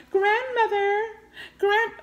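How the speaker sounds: high mock cry for help; high-pitched imitation of someone calling; mimicked calling out. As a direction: call out high, mocking a movie character. Mimics film character calling grandmother.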